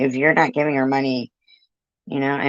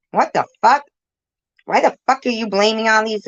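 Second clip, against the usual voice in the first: real indignation, high and raised; fast, high-pitched angry protest; indignant outburst; 8 semitones higher, 4 dB louder, faster than usual. Real, not acted. Caller blamed for other accounts, indignant. Outraged at being falsely blamed.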